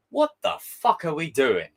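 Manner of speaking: usual speaking voice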